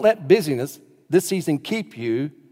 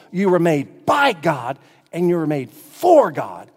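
raised, emphatic pulpit voice; impassioned preaching; 4 semitones higher, 6 dB louder than usual. Real, not acted. Preacher telling congregation only God fills the hole, impassioned. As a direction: preach it loud with drawn-out key words. Preacher builds to full force.

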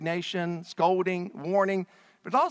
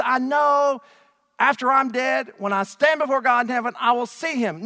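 Mary insisting; 6 semitones higher, 7 dB louder than usual. Acted. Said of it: "She says".

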